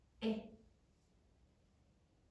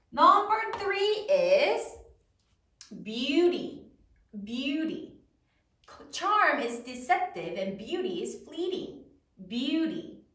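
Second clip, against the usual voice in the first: normal talking voice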